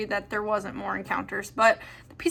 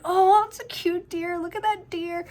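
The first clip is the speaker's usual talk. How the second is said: high, slow gushing coo; cooing cute voice; 8 semitones higher than usual. Retold or imitated. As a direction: coo like at a puppy. Gushing past self over deer.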